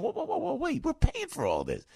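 high, startled protest; mock alarm. Host voicing taxpayers' alarm at the backslapping, high and startled. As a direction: cry out as if catching a cheat. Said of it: "you and I are going".

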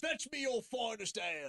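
high, hollered tavern order; Renaissance fair patron. Acted. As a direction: call for ale like a hammy medieval customer. Plays a fair-goer ordering ale.

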